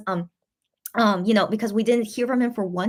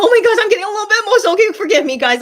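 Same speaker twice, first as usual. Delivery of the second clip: laughing through sudden emotion, higher, louder and fast; emotional laugh; 11 semitones higher, 9 dB louder, faster than usual. Real, not acted. Streamer laughing off tears in front of viewers, emotional laugh. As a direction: laugh it off while choked up. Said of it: "Why am I getting emotional?".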